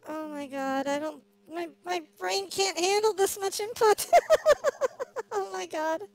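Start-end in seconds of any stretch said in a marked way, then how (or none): 4.1-5.3 burst of laughter. Real, not acted. Overwhelmed by input.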